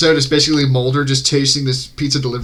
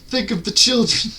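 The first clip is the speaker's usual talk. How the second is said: high-pitched, hammed-up plea; mock alarm; 9 semitones higher than usual. Joking about fish eating children, mock-alarmed plea. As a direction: plead shrilly in pretend concern.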